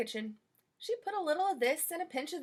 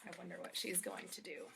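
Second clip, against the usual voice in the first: much softer wondering to listeners; hushed aside; 5 semitones lower, 14 dB quieter than usual. Real, not acted. Reader teasing children about what comes, hushed. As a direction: drop to a near whisper. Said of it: "reader aside hushed".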